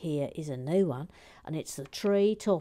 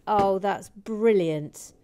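usual speaking voice

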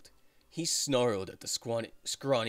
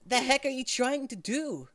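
put-on high-pitched young guy snapping; too high main character voice; 12 semitones higher than usual. Shown as a demonstration. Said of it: "that's too high pitched".

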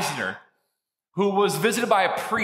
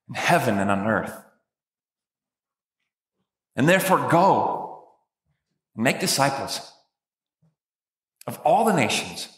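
solemn voice of Jesus; 4 semitones lower than usual. Acted. Jesus sending his disciples into the world, solemn. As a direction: deliver the great commission with gravity. Preacher re-reading Jesus' words.

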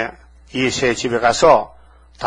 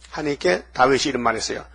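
ordinary talk, nothing marked

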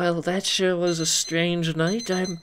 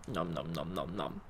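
mock munching; 12 semitones lower, 10 dB quieter than usual. Pretend eating the bird.

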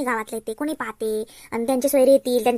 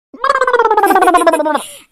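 long, loud peal of laughter; 3 semitones higher, 9 dB louder than usual. Acted. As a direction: burst out laughing at the muddle. Laughs at aunt's confusion. (मोठ्याने खळखळून हसणे)

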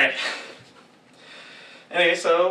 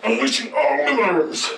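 robot announcer voice; 5 semitones higher, 4 dB louder than usual. Shown as a demonstration. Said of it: "do it without talking".

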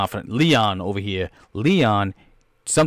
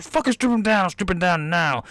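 mock angry boss; 7 semitones higher than usual. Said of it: "boss yelling orders".

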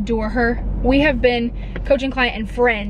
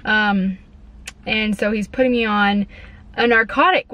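ordinary talk, nothing marked

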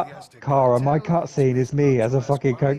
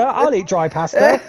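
ordinary talk, nothing marked

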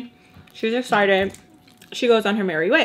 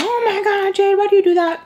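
high, louder scolding viewer voice; mock viewer outrage; 11 semitones higher, 4 dB louder than usual. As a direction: play a shocked follower calling you out. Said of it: "some of you are gonna be like".

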